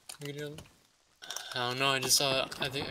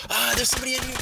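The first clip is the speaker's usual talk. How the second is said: high, loud surprised cry; startled shout; 10 semitones higher, 6 dB louder, faster than usual. Real, not acted. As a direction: jump and yell it out. Finds enemy inside.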